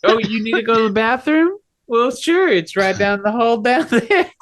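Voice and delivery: very high, put-on falsetto voice; high-pitched game character giving directions; chirpy helper character. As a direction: play a helpful host in a squeaky falsetto. GM plays a character answering player.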